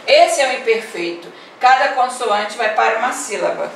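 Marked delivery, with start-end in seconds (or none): none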